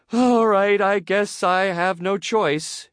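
high, loud, slowing reluctant surrender; reluctant gulp. A narrator's reading. Teacher with no choice left, reluctantly giving in. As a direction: give in unwillingly, dragging the words out. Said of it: "she gulped".

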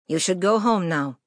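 put-on broad Quebec French accent, firm nurse; nurse's coarse Quebec French accent. A narrator's reading. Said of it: "in her broad Quebec French".

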